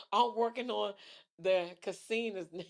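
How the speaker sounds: rereading it through real laughter; cracking up while reading the comment back; a giggle breaking into the words; laughing. Real, not acted. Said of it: "I'm crying".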